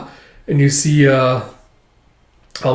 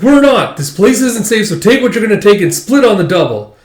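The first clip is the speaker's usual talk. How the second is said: high, loud, urgent warning; heated denial; 7 semitones higher, 5 dB louder than usual. Retold or imitated. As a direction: shout them out fast. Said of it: "It's like".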